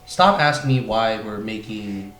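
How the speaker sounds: normal talking voice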